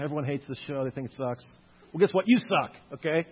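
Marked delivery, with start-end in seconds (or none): 1.9-3.3 loud playful jab, a touch of real heat; teasing shout at the haters, tongue in cheek; mock insult. Lecturer jokingly snaps at people who hate Futurama, loud mock insult.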